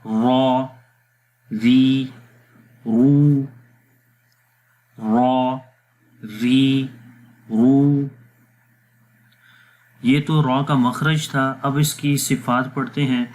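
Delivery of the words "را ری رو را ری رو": را thick, ری thin, رو thick, each said apart; separate ra ri ro. Shown as a demonstration. Teacher drills ra ri ro separately for students. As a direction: drill the three vowels one by one. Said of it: "بغیر جوڑ کے پڑھیں گے".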